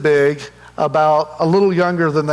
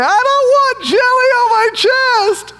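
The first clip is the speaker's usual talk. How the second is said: put-on much higher, slow, wailing little girl's voice; whiny toddler; 4 dB louder than usual. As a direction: protest like a scared two-year-old refusing the gel. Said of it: "And she's like".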